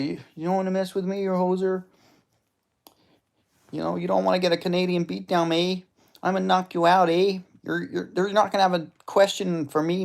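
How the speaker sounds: high-pitched Canadian tough-guy trash talk; Canadian accent. Acted. Imagined Canadian fighter threatens his opponent with a beatdown, mock Canadian accent. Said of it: "I'm just picturing this Canadian guy".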